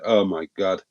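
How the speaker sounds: ordinary talk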